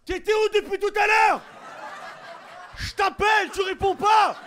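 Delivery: put-on very high nagging girlfriend voice; hammed-up girlfriend scene to scare off stalker; mock jealous girlfriend. Playing jealous girlfriend to follower.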